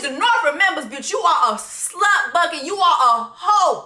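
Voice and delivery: ordinary talk, nothing marked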